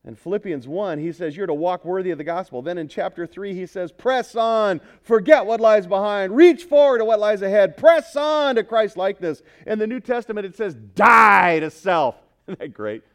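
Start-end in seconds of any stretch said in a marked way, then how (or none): 3.9-9.4 loud, raised scripture exhortation; rousing preaching. Retold or imitated. Preacher quoting Paul's commands to congregation, rousing and loud. 10.7-12.2 slow, loud preacher's cry on "Die"; thundering command. Retold or imitated. Preacher quoting New Testament to congregation, thundering.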